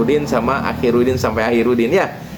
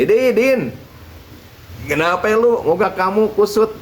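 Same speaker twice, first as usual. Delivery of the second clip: put-on higher, teasing old cleric; teasing kiai; 6 semitones higher than usual. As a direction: poke fun at Udin like a kindly kiai. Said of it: "Kata Pak Kiai sambil ngeledek". (suara kiai yang meledek, lebih tinggi)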